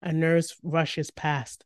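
ordinary delivery

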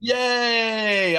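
high, loud happy cheer; excited cheer. Real, not acted. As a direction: cheer it out, thrilled.

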